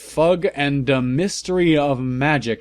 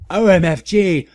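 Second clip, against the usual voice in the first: higher and louder exclamation; yelled; 6 semitones higher, 7 dB louder than usual. A narrator's reading. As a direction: narrator jumping up at visitors, startled yell. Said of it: "I yielded as I jumped up".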